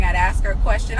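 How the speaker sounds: ordinary talk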